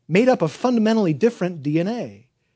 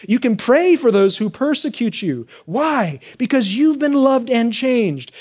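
louder, higher driving sermon delivery; impassioned preaching; 6 semitones higher, 5 dB louder than usual. Real, not acted. Preacher telling congregation they've been loved and changed, impassioned. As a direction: preach it hard, punching each answer.

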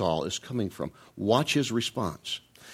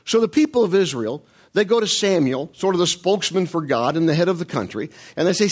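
usual speaking voice

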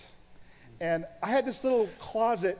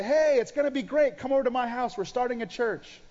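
bright, raised, louder hello to a stranger; cheery invitation; 6 semitones higher, 4 dB louder than usual. Retold or imitated. Pastor mimicking his eager pitch to a stranger on the street, upbeat and louder. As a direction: sell it like an eager new pastor.

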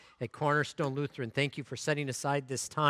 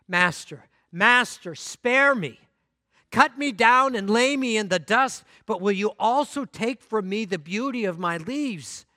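desperate pleading; 7 semitones higher, 8 dB louder than usual. Acted. Bamboo begs master.

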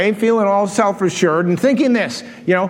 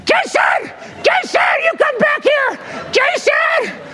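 very high, strained comic screaming; frantic re-enacted yell; yelling after someone; slower than usual. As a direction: screech the name down the street. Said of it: "riding down the street yelling".

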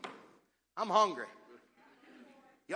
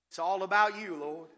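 usual speaking voice

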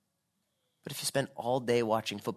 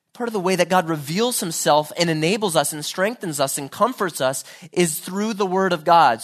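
normal talking voice

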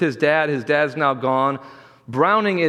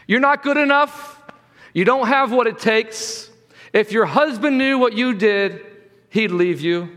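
higher-pitched, mildly raised accusing voice; yelling accuser; 6 semitones higher than usual. Acted. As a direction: imitate an accuser hurling taunts, easing at the end. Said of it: "yelling at you".

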